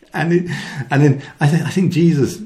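ordinary talk, nothing marked

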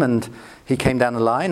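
ordinary talk, nothing marked

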